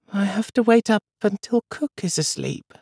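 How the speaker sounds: put-on high, hushed small boy; a sleepy boy whispering a secret; whispering little boy. A narrator's reading. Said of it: "Charles whispered".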